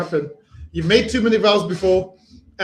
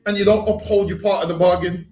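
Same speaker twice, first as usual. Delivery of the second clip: usual speaking voice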